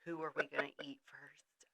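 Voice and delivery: whispered dark joke; mock panicked whisper. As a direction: hush it like a secret panic. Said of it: "moment of panic of, like".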